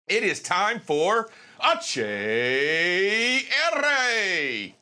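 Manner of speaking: slow, high announcer spelling out letters. Acted. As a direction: drag out each letter like a show host. Announcing the show segment.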